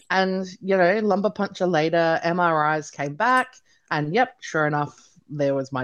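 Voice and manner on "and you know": normal talking voice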